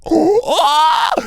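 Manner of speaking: high, loud mock stretching cry; stretching groan. The tall cop stretching up out of the car, high loud groan. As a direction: let out a big yawning stretch sound.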